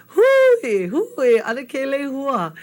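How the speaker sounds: high, loud opening call. Acted. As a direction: call out at the door like a human doorbell. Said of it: "he's ringing his Hawaiian doorbell".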